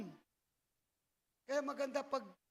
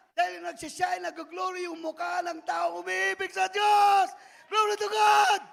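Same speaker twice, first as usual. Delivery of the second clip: loud, slow preacher's cry, higher on Glory to God; shouting praise; 4 semitones higher, 11 dB louder, slower than usual. Acted. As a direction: lift the room with a shout. (malakas na sigaw ng papuri)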